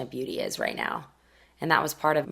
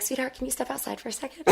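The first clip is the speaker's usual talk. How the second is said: much softer, higher, sugary calm with a laugh in it; too-sweet hush before the storm; sweetly quiet mother; 8 semitones higher, 10 dB quieter, faster than usual. Retold or imitated. Mother sending her daughter out before confronting the agent, sugary and quiet.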